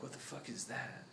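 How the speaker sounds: whispered, frightened question; scared whisper. Acted. Kid staring at the grotesque mask, a scared whisper. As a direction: hush it like he's afraid to look. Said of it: "the kid's looking at it like".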